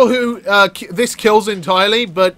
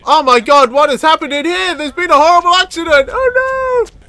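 mock horror; 9 semitones higher than usual. Culprit feigning shock at a horrible accident, mock horror. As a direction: wail in fake shock, climbing ever higher.

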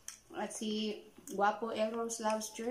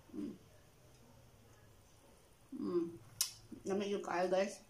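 chewing while speaking; talking between bites; mouth partly full; 4 dB quieter than usual. Real, not acted. Seen 1: Tofu bitten at -3; closed-mouth chewing, then speaks with food.

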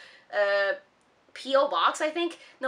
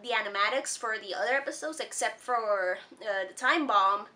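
usual speaking voice

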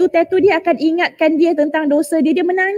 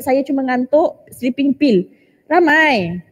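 ordinary talk, nothing marked

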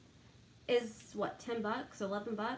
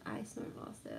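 whispering; 12 dB quieter than usual. Retold or imitated. Wife retells husband's words.

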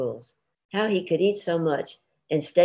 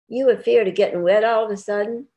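raised, louder needling question; teasing challenge; 6 semitones higher, 5 dB louder than usual. Acted. Husband needling his wife about the rain, teasing and louder. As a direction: poke fun at her fear of the rain.

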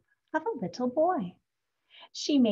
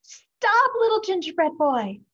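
high, loud shout after him; 10 semitones higher, 7 dB louder than usual. A narrator's reading. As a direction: yell it down the road.

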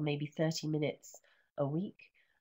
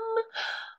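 sharp high excited gasp; a gasp of mock suspense. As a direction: gasp in delight. Suspense before reveal.

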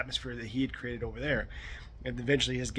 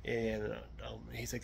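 normal talking voice

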